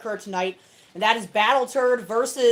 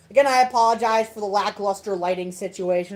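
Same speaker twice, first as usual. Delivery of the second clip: ordinary delivery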